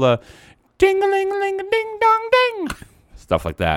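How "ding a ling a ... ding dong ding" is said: high sung ding-a-ling; sung jingle. Shown as a demonstration. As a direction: chime it high like sleigh bells. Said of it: "they do the whole".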